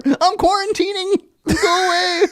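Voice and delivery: mock teenage son. Son answering his dad's knock, whiny high teen voice. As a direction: play the sulky kid behind a locked door. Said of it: "Like, you knock on the door".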